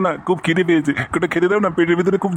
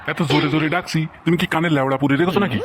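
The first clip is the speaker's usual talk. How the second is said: ordinary delivery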